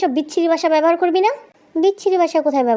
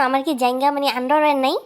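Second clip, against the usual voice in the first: usual speaking voice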